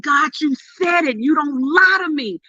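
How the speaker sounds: high, fervent cry to God; retold screaming. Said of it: "I was screaming 'cause I was like".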